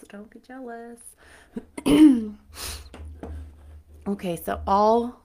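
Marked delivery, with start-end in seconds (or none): 0.1-1.0 much quieter teasing echo; soft repeat. Pretend. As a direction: drop to a soft tease. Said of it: "I have an amazing rat tail".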